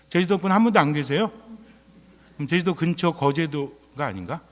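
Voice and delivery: ordinary talk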